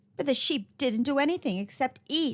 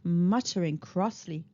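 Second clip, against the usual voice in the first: usual speaking voice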